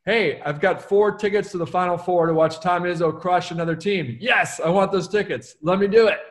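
emphatic yes. Acted. Author acting out an offer of game tickets, emphatically keen. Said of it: "Example of emphatic yes".

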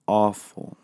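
ordinary delivery

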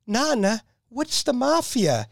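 put-on high, slow, innocent kid voice; curious little boy. Little boy asking his Nana, high and slow. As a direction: ask it wide-eyed like a five-year-old. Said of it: "He says, he goes".

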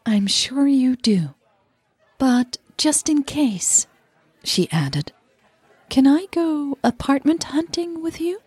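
Leah's voice. Acted. Leah voiced higher.

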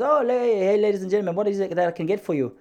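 high, effusive waiter's greeting; imitated Italian waiter. Waiter in Bologna taking the order, high showy voice. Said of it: "the waiter came out and he goes".

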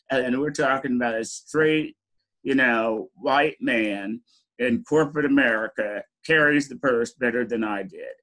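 ordinary delivery

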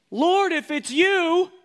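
high, loud, slow call; Peter calling out. Acted. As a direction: shout across the water, hopeful. Peter calls to Jesus.

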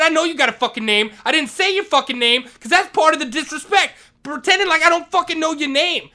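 angry yell. Retold or imitated. Vice principal explaining the disrespect, angry yell. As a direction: lecture him while yelling. Retold shouting match with principal.

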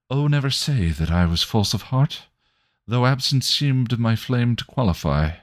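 lofty, lilting verse reading; poem recital. Acted. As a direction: recite it like a sonnet. Recites sonnet.

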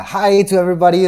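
imitating your own Italian-accented greeting. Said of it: "Italian accent".

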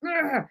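high-pitched growling "ugh"; worked-up growl. Retold or imitated. Woman fired up alone in the room, worked-up growl. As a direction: growl it out in mock fury. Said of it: "I was in the room like".

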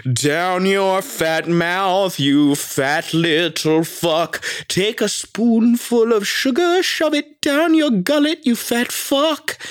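sweet nanny spitting insults; Mary Poppins with a potty mouth. Pretend. Mary Poppins-style nanny cursing out someone, prim sing-song. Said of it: "like, if Mary Poppins, like, had a potty mouth".

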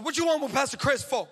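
high, sassy challenge; spicy assistant. Retold or imitated. Assistant challenges the crying woman, spicy. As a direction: imitate a feisty assistant demanding an answer. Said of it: "she comes with all the spicy".

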